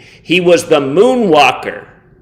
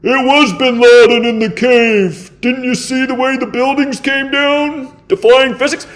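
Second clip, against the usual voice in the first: mocking quote; 5 semitones higher than usual. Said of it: "seems to be all that exists".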